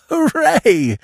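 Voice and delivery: high, emotional cheer; cheer, overcome with joy; weak joyful hooray. A narrator's reading. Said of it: "in a voice weak with emotion".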